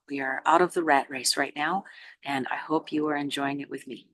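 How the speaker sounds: guided meditation. Real, not acted. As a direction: guide them unhurriedly into listening. Leads a meditation.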